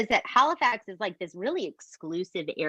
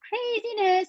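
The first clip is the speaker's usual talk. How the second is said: emphatic; 13 semitones higher than usual. Real, not acted. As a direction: squeal the word out. Stressing how wild it is.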